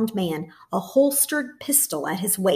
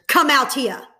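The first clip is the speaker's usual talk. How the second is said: loud, higher command; ordered; 7 semitones higher, 6 dB louder than usual. A narrator's reading. Officer ordering the girls out of bed, loud. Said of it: "he ordered".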